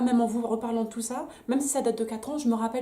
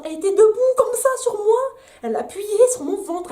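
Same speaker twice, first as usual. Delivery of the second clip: much higher and louder, indignant; outraged exclaim; 10 semitones higher, 10 dB louder than usual. Real, not acted. Mother telling viewers how the nurse pressed her belly, outraged and loud. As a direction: cry out in disbelief at what she did.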